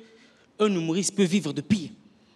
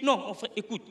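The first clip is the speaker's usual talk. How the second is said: pleading with the crowd; 6 semitones higher, 3 dB louder than usual. Retold or imitated. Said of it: "j'étais là à supplier le public".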